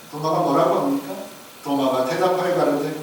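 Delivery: ordinary talk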